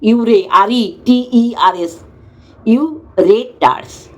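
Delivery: very slow, letter by letter ইউরে, R E T E R S; slow correct ইউরেটার্স after a mocking stumble; spelling it out. Shown as a demonstration. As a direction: spell ইউরেটার্স deliberately, each letter apart. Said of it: "নিজস্ব উচ্চারণ".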